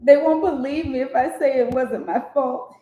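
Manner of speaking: high, loud panicked plea; hysterical manager. Acted. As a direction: wail it like a frantic man. Hysterical manager line read aloud.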